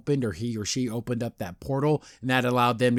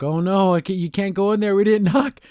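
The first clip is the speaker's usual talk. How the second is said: higher, lightly acted alarm; mock worried protest; 6 semitones higher, 3 dB louder than usual. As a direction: protest with mild pretend panic. Voices girl's worried protest.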